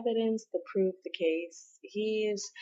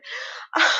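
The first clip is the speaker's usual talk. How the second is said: high unvoiced laugh; 5 dB louder than usual. Real, not acted. Reacting to weird scene.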